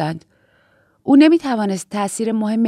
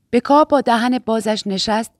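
usual speaking voice

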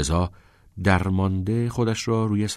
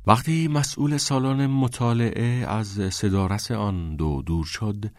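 usual speaking voice

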